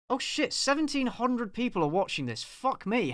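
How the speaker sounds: surprised. Real, not acted. Surprised by viewer count.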